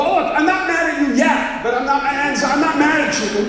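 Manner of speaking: high, loud mock-irritated insistence; acted-out testy protest; exasperated denial. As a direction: insist you're not mad, voice rising in exasperation. Acted argument with his son.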